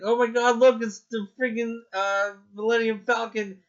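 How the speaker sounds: high, loud excited gushing; thrilled fan reaction. Retold or imitated. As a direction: re-enact giddy amazement, pitched well up. Acting out thrilled trailer reaction.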